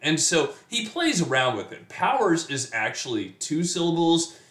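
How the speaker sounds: usual speaking voice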